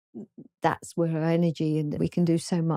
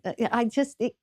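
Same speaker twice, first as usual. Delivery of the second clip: normal talking voice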